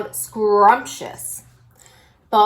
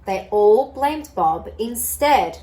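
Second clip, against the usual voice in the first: ordinary delivery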